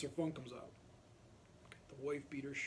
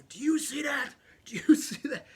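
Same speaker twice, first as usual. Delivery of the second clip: raspy furious hiss, much higher and louder; angry whisper-shout; 11 semitones higher, 13 dB louder than usual. Retold or imitated. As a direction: hiss it into his face, menacing. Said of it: "He's going".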